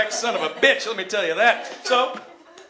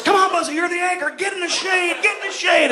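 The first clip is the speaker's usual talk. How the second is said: higher mock-urgent coaxing yell; pleading kid; 10 semitones higher, 4 dB louder than usual. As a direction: nag Buzzy into the shade. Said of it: "And Joel was".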